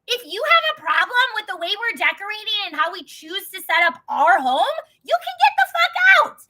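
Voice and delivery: angry ultimatum building to a shout; retold blow-up, exploding at the end; snapped. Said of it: "I just, like, snapped".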